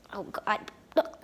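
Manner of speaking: ordinary talk, nothing marked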